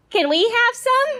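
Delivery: mock child asking; little kid. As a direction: ask like an eager little boy. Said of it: "he said".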